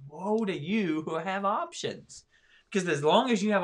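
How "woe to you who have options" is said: slow, high, drawn-out preacher's warning; Jesus quoted. Retold or imitated. Podcast host voicing Jesus to listeners, slow and solemn. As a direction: stretch each word out like a solemn prophet. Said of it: "And so he's just going".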